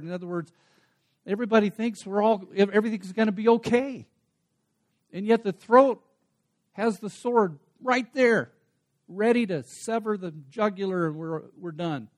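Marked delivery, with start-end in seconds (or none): 1.3-11.1 rising preaching. Acted. Preaching surge about doom.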